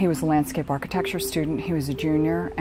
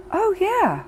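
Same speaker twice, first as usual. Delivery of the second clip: nonchalant. Retold or imitated.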